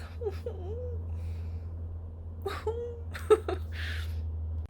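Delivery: soft, high, drawn-out whimpering moan; overwhelmed wail. Real, not acted. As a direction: whine softly, overcome by the book. Overwhelmed wail about the book.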